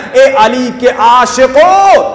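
long, drawn-out rallying call; slow, swelling preacher's summons; rousing call. Real, not acted. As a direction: call out to the faithful, stretching the last word. Preacher calls out to congregation.

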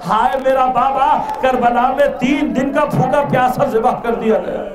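impassioned cry of grief, pitched high; preacher's agonised wail; anguished lament. Acted. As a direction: cry out in mourning for a father. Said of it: "تڑپ کر کہتا".

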